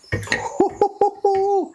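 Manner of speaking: very high, hooting laugh; delighted laugh. Real, not acted. Vlogger seeing the cool new finish, high delighted laugh. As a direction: let out a high giggle of delight.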